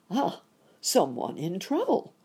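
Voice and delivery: concerned cry. A narrator's reading. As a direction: call out in sudden concern. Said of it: "cried the rabbit gentleman".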